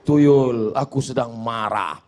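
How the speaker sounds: low, slow, subdued confession; plaintive client. Acted. Man telling a tuyul of his anger, low voice. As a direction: confide it in a low voice to a spirit.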